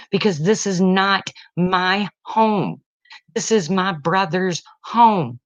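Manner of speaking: normal talking voice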